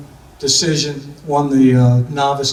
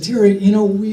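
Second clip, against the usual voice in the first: put-on lightly done Texas accent, higher pitched; 5 semitones higher, 4 dB louder than usual. Coach consoling Terry after his knee injury, in a put-on Texas accent. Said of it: "Is that my Texas accent?".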